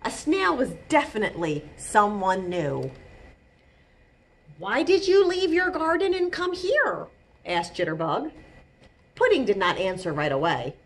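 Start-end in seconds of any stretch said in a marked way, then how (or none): 4.6-7.1 high-pitched questioning voice; curious chipmunk. A narrator's reading. Jitterbug asking the snail why it came, curious. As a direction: ask as a puzzled little chipmunk.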